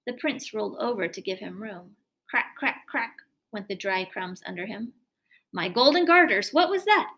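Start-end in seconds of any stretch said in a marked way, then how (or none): 5.5-7.1 loud, high panicked cry of a startled king; much louder, higher frightened outburst. A narrator's reading. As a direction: cry out like the floor just gave way. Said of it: "cried the king, frightened out of his wits".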